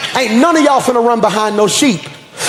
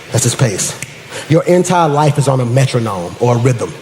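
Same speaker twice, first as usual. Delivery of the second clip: much lower, settled preacher voice; low sermon wind-down; 9 semitones lower, 3 dB quieter than usual. Real, not acted. Preacher closing on trusting God's pace, low and measured. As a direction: bring it right down and let the phrases sit between pauses. Drops to hushed closing teaching.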